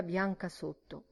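ordinary delivery, nothing marked

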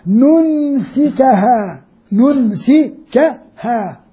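ننسكها very slowly, syllable by syllable; ننسكها drawn out very slowly; slow spelled-out demo. Shown as a demonstration. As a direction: break ننسكها into its parts for the class. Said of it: "مفعول أول خطاب للنبي".

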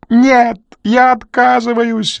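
high, slow, loud panicked yell. Acted. Crew yelling his refusal at Renly. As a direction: scream your refusal. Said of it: "заорал Крю".

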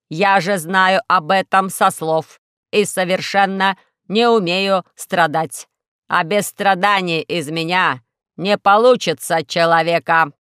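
slow, loud, solemn robot voice; a robot earnestly explaining itself; slow and loud robot philosophising. Acted. Robot musing about suffering.

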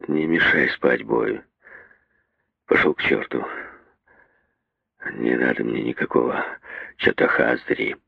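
low, grumpy half-asleep grumble; sleepy angry muttering. A narrator's reading. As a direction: mutter crossly, barely awake. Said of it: "сердито забормотал спросонья".

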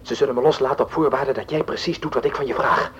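ordinary delivery

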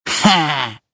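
mock-triumphant exclamation; gleeful Ha. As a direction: pounce with a gleeful bark of a laugh. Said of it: "радостно воскликнула Аргументина".

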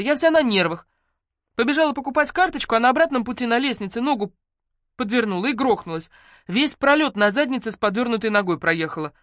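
put-on high, loud, agitated young friend; frazzled, complaining character voice; aggrieved patient.

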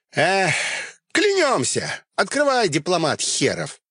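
high barked promise, then sneer; gruff grudging oath. Acted. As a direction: swear it, then mock him. Koptich swears grudgingly, shouting.

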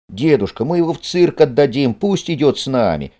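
put-on pleading boy. A narrator's reading. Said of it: "умоляет Пашка".

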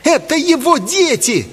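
very high, overwrought cry; accusing boy. A narrator's reading. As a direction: blurt the revelation in falsetto. Boy's climactic accusation.

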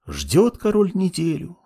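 usual speaking voice

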